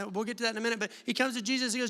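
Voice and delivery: ordinary talk, nothing marked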